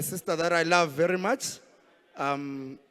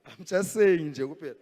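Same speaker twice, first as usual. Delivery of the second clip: normal talking voice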